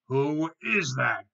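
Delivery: slow, loud, drawn-out challenge; troll's loudest roar. A narrator's reading. Troll challenges big goat, slow furious roar. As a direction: roar each word out slowly. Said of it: "he roared louder than ever".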